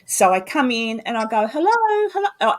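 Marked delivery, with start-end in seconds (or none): 1.5-2.5 high sing-song hello; friendly visitor greeting. Shown as a demonstration. Investigator entering a haunted location, cheerily calling hello. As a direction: call out as if entering someone's home. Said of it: "I actually act like that".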